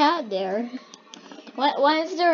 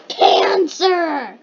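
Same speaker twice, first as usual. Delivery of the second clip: dramatic; 4 semitones higher, 6 dB louder than usual. Pretend. Mock dramatic reading of heading.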